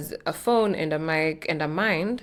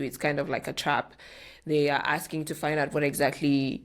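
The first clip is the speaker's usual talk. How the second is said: ordinary talk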